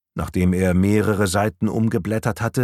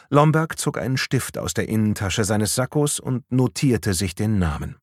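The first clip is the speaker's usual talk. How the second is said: ordinary delivery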